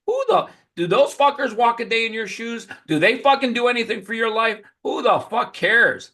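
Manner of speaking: mild mock tirade; retold rant. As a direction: rant at the haters. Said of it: "I'm like".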